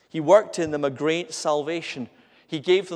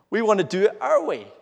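higher, louder show of rebellion; mock defiance; 5 semitones higher, 5 dB louder than usual. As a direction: insist on it like a disobedient people. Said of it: "You see the pattern of obedience, of disobedience".

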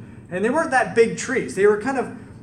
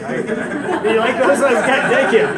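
louder, delighted thanks; pleased surprise; 5 semitones higher, 7 dB louder, slower than usual. Real, not acted. Preacher delighted the congregation likes his chips, excited. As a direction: beam at the crowd's approval. Reacting to audience about chips.

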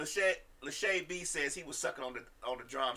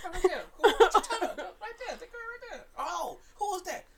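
much higher, squealing nosy tone; high nosy gossip voice; 12 semitones higher than usual. Pretend. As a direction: play the gossipy busybody. Acting out spotting her.

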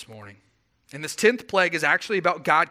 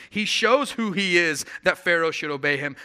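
normal talking voice

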